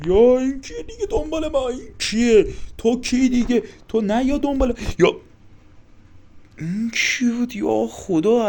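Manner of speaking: high, loud cries of alarm; panicked yelling. Real, not acted. Player shouts at the thing suddenly chasing him, panicked yelling. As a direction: yell in panic at a pursuer.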